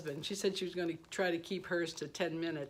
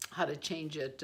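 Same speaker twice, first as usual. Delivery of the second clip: normal talking voice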